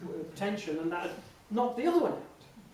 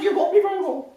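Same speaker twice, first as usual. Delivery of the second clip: high, loud, odd two-note voice; exaggerated impression of a breaking-up voice; Anne Widdecombe; 11 semitones higher, 11 dB louder, faster than usual. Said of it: "And she said".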